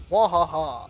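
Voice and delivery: loud villain mwahaha; evil cackle. Pretend. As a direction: cackle like a mad genius. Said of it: "I'm an evil genius".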